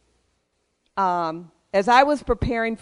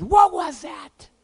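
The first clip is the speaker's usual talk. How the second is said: mock-shocked cry, pitched way up; startled reaction; 10 semitones higher, 4 dB louder, slower than usual. Retelling a friend's reaction to a strange passerby, shocked. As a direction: imitate a friend's shocked outburst, high and drawn out. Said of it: "Kevin looked at me and says".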